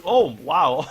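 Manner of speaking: sudden high, loud surprised gasp. Real, not acted. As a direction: caught off guard by a clutch play. Clutch play surprises the caster.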